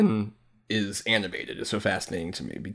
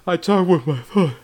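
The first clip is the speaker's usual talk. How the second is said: sleepy mumble; 6 semitones higher than usual. Retold or imitated. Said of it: "trying to tell, just like".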